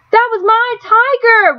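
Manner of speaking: high, slow screaming grief; anguished scream. A narrator's reading. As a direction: scream it at your father. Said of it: "Rob screamed".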